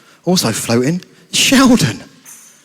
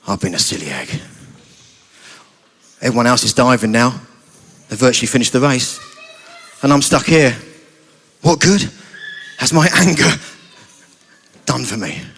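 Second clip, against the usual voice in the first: low, subdued self-reproach; acted gloom, voice dropped low; dejected; 8 semitones lower than usual. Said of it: "a little bit dejected, a little bit sad".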